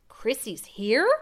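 slow, wondering question; can't believe what he hears; stunned disbelief. Acted. Father hearing his lost daughter came home, stunned.

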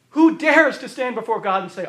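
ordinary talk, nothing marked

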